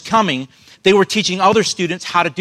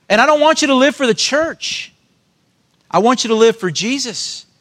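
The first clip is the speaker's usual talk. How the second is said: impassioned preaching; 4 semitones higher than usual. Acted. Preaching at full force.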